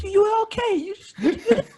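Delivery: very high-pitched condescending reassurance; mock consolation. Audience member consoling the comic, high mock pity. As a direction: squeak out faint praise. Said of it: "I hate that one that goes".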